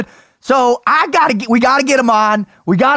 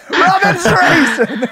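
laughing; 5 semitones higher, slower than usual. Real, not acted. Laughing repeat.

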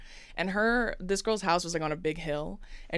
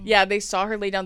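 ordinary talk, nothing marked

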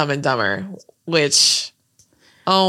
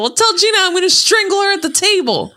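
mock-crazed movie threat; 13 semitones higher, faster than usual. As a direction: spit out the threat fast. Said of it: "She's like".

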